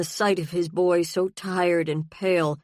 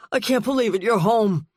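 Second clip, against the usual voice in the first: higher and louder, relief breaking through; overwhelmed relief; 5 semitones higher, 4 dB louder than usual. A narrator's reading. Father repeating to his returned son that he is home, rising with joy. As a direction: let the joy rise as it sinks in. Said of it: "He said the words over and over".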